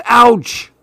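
high-pitched mock cry of pain; pained ouch. A narrator's reading. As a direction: yelp as if lying on rocks. Child imagines bed of rocks.

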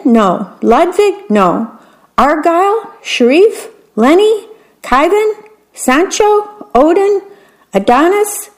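high, rising guesses one after another; high questioning list of names; rapid name guessing. A narrator's reading. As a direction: reel off names hopefully. Queen rattles off names.

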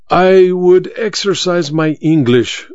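a hint of the masked magician; foreign magician. A narrator's reading. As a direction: savour the English words. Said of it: "said the mask".

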